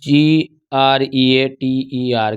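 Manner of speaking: slow, each letter of जी आर ई ए टी ई आर said apart; spelled letter by letter. Shown as a demonstration. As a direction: spell it out slowly, one letter at a time. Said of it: "spelling out greater". (धीरे-धीरे एक-एक अक्षर बोलकर spelling)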